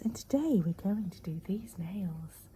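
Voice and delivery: slow, hushed ASMR-style whisper; imitating calm whisper. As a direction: whisper it soothingly, like a calm nail tutorial. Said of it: "she speaks very calmly like this".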